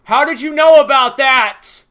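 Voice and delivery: high, loud, shocked whiny voice; over-the-top shocked YouTuber voice; mocking Cyberboy. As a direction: ham up his fake surprise. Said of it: "he'll be like".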